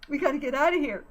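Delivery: high, urgent insistence; insisting. A narrator's reading. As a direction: urge them to leave now. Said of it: "Kelly insisted".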